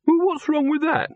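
very high-pitched defensive question; indignant demand. A narrator's reading. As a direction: demand it, voice shooting up. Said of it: "Silverfish demanded".

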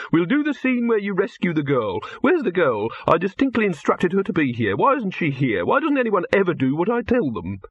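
high, swooping voice of complaint; exasperated film director. Acted. Director looks for the missing actress, exasperated. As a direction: build from orders to exasperated questions.

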